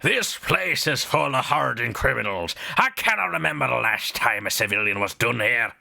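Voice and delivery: put-on mild Scottish accent on the governor; a light Scottish brogue in a cool villain tone; Scottish Wesker. Governor warns visitors in a light Scottish Wesker voice. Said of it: "Scottish Wesker?".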